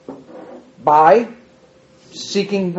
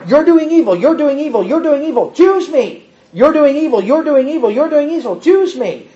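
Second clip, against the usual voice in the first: raised-pitch insistent chant of God; voice of God pleading; 7 semitones higher than usual. Acted. God calling his people to choose him, insistent raised chant. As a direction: press it again and again, a divine plea. God's repeated call voiced.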